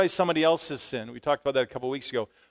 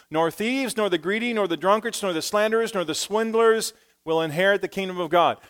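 preaching scripture; 4 semitones higher than usual. Acted. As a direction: proclaim the verses with building force. Reads scripture with force.

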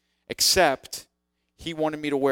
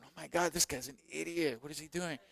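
much softer, snickering aside; mocking whisper; 11 dB quieter than usual. Teens in the crowd quietly mocking the announcer, soft aside. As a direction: mutter it like a kid mocking from the pews. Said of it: "laughing and going".

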